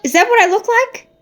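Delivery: high, offended, rising on what; Piranha, insulted by Susan, high indignant squeal; indignant yelp. Acted. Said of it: "Piranha yelped".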